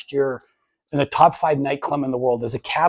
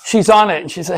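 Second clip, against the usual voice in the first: usual speaking voice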